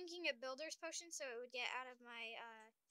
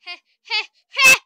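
laughter turning nervous; 2 semitones higher, 21 dB louder than usual. Real, not acted. Caught on recording, laughs.

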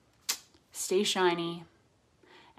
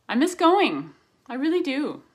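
emotional; 10 semitones higher, 9 dB louder than usual. Real, not acted. Emotional heartfelt outburst.

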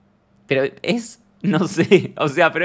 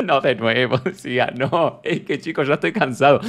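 tired Pablo. Retold or imitated. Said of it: "Pablo debe estar pensando".

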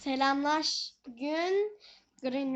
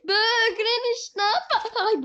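panicked cry; 8 semitones higher, 7 dB louder than usual. Real, not acted. Panicking as Granny approaches.

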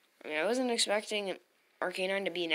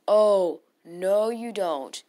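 loud, slow defiant warning; slow and loud, refusing to let it happen; 11 dB louder, much slower than usual. Real, not acted. Blocking opponent's move.

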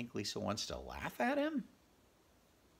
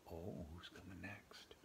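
whisper; 5 semitones lower, 14 dB quieter than usual. Real, not acted. Whispered page turn to child.

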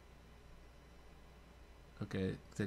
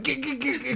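higher stuttered "g-" sounds; stuttering glitch imitation; 9 semitones higher, 6 dB quieter than usual. Host mocking a glitching clip, higher stuttered "g-". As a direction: mimic a video skipping.

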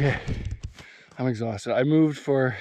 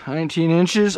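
excited; 5 semitones higher, 8 dB louder than usual. Real, not acted. Repeating the measurement proudly.

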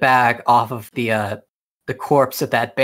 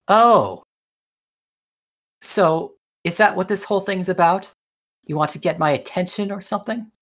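higher, quick skeptical princess; 6 semitones higher than usual. Acted. Princess questions the knight's motives, higher and quick. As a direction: tease him, a little sharp and higher. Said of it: "The princess just looks and says".

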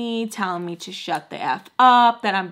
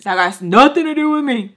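loud, dismissive guard voice; stern guard; 4 semitones higher, 7 dB louder than usual. Retold or imitated. Guard dismissing the girl, loud. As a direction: brush her off as the guard. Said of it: "And he goes".

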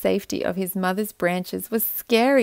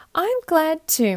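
lightly voiced mother tree, a touch higher; gently reply as the mother oak; a hint of a gentle mother; 8 semitones higher than usual. A narrator's reading. Mother oak comforting her little acorn, lightly voiced. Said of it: "Mother Oak".

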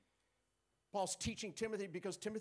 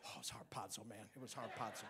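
much lower, laid-back dude voice; casual cool guy; 9 semitones lower, 7 dB quieter than usual. Pretend. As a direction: drop into a relaxed slangy brag. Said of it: "How was church?".

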